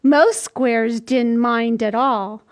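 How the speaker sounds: normal talking voice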